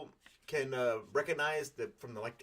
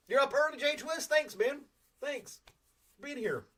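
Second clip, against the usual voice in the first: cheery greeting; 13 semitones higher, 4 dB louder than usual. Real, not acted. Greeting a viewer in chat.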